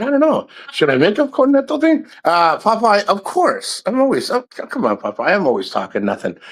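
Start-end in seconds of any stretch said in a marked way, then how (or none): 0.0-2.1 much higher-pitched mock wondering; teasing pretend indecision; retold coy reply. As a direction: recount your reply in a high, playful pitch. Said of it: "I said".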